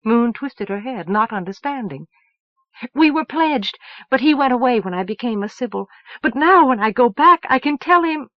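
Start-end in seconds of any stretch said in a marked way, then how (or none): 2.9-8.3 higher, louder, rushing eagerly; eager excitement. Acted. Moon to Elsevier about going home to Sparks: eager rushed excitement. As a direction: hurry out the happy plan.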